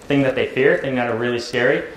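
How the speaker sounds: usual speaking voice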